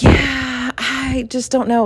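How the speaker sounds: high, slow unsure dithering; stall, torn and worried; nervous hesitation. Retold or imitated. To her husband before parasailing, high nervous dithering. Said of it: "And so I was like".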